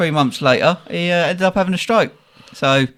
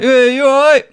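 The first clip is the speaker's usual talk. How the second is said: loud, high friendly call through the window; dad greeting the dancer through the glass, raised cheery voice; 9 semitones higher, 5 dB louder than usual. Retold or imitated. Dad taps window, calls out.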